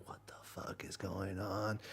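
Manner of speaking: voiceless stunned whisper; hushed mock disbelief, all breath. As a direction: imitate baffled onlookers whispering at a doorway. Friends peeking in the door.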